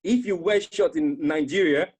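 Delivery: high, loud, excited teasing; excited friend. Retold or imitated. Friend thrilled by the shorts photo, high loud excited teasing. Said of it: "He's like".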